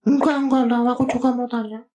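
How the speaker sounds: high character voice cutting in to ask Kak Bagas; Bobi voice. Acted. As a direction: pitch it way up like a little puppet friend. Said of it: "Bobi tanya deh".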